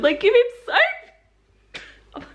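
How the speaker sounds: very slow, high, laughing; giggling aside. Real, not acted. Vlogger amused at the plot, slow and giggly. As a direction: stretch it out laughing.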